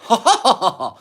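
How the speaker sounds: high, loud laugh on demand; requested laugh. Real, not acted. Son doing his laugh for the stream on request. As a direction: let out your laugh when asked. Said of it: "can you do your laugh".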